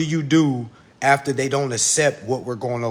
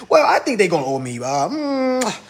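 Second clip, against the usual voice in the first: higher-pitched mock clueless claimant; put-on pondering voice, pitched up; mocking mimicry; 7 semitones higher, 5 dB louder than usual. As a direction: imitate someone guessing, rising into a hum. Mocks people making up sums.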